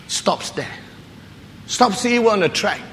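ordinary talk

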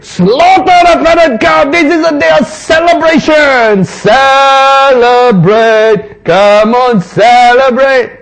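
loud, high, slow festive shout; joyful command. Acted. Father ordering the servants to feast, loud joyful shout. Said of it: "The father said".

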